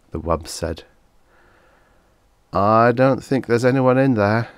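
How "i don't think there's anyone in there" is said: usual speaking voice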